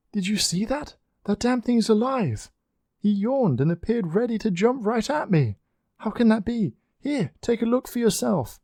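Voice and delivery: very high, breathless panic; terrified. A narrator's reading. Annette tells Oriel about the phone, in terror. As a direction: she can't believe what she saw. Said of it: "Annette screamed in terror".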